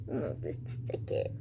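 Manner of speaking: breathy whispered aside. Real, not acted. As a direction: whisper it off to the side. Aside while interrupted.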